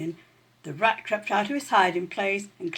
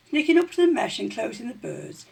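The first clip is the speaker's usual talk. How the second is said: usual speaking voice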